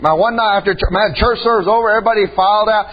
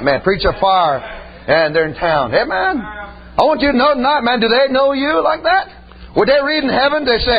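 ordinary talk

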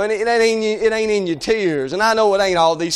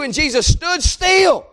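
preaching shout; 6 semitones higher, much slower than usual. Acted.

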